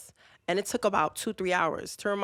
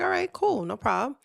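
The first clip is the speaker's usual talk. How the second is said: chirpy okay; 7 semitones higher than usual. Retold or imitated. Mock cheerful agreement again.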